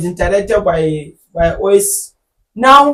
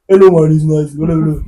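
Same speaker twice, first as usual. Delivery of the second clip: lightly lowered mock announcer voice; mocking radio voice; 4 semitones lower, much faster than usual. Host mocks how presenters greet listeners, in a lightly lowered voice. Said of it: "That is how they talk".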